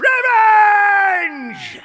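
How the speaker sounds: big hammy shout of revenge. Acted. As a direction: bellow it like a vow.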